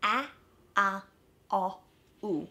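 the ow sound split into four separate vowels, "A, a, o, u"; separate vowel steps. Shown as a demonstration. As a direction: say "A, a, o, u" one at a time, with gaps. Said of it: "slide them together".

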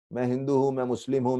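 ordinary talk

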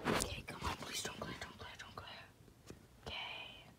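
breathy pleading whisper. Real, not acted. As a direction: hush and beg it to work. Pleading with glare while filming.

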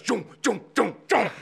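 punched, repeated movie zoom noises; staccato imitated zoom-in sounds; camera zoom effect. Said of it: "the fucking camera shot goes".

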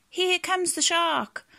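high, drawn-out storybook alarm; alarmed cry. Acted. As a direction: cry a slow, high-pitched warning. Fish calling out as shark approaches.

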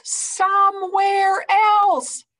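very high, loud slow yell; Gerald shouts. A narrator's reading. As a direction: blast it out so birds fly off. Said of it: "Gerald shouts".

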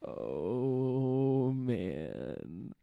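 loud, long drawn-out groaning words; dismayed groan. Real, not acted. Friend reacts to a lewd dinosaur joke, dismayed groan. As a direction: stretch it out, dropping at the end.

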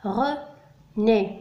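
René split into two syllables, "re", pause, "né"; René pronounced in separated, careful syllables. Shown as a demonstration. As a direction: say René slowly as re... né. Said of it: "نسمع طريقة نطق الكلمة".

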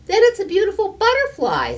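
high, loud and fast delight; excited child. A narrator's reading. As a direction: burst out with joy. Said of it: "said Harriet".

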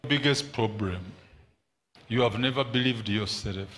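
normal talking voice

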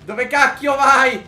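high, loud, drawn-out protest; exasperated yell. Real, not acted. Player scolds co-op partner steering wrong, exasperated yell. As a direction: shout at a driver veering off. Yelling at partner driving badly.

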